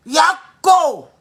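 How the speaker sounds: a high, loud cry of Yako; shouted yako. Real, not acted. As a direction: call out Yako to the nation. Calling condolence to the country.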